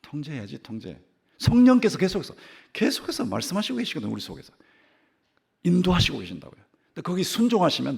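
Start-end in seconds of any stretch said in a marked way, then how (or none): 1.4-8.0 loud, high preaching to the congregation; fervent preaching. Acted. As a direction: drive it home from the pulpit. Preacher at full force.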